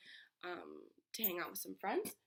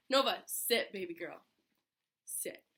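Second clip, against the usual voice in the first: calling the dog; 7 dB louder than usual. Real, not acted. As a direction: call her name out firmly. Calling her dog to sit.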